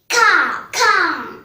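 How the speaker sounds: high, loud imitated caws; crow cawing. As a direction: caw out like an agitated crow. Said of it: "the crow said".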